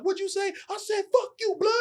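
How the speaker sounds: high taunting voices; high mock street taunt. Young actor mimicking street standoff on set, high taunt. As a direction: play both sides of a street spat up high.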